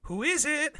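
put-on high-pitched little kid calling; kid's voice. Kid answering the house door, high put-on voice.

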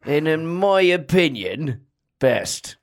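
put-on a light Yorkshire accent, unhurried. A podcast host asked for Yorkshire names his favourite apes, in a light Yorkshire accent. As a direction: talk about apes like a Yorkshire local, lightly done. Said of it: "What about a Yorkshire?".

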